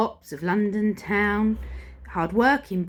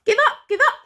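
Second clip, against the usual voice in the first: high loud gang taunt; robbers cry; 6 dB louder, much faster than usual. Acted. Robbers to the officer, loud and high. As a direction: yell it like a gang. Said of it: "the robbers cried".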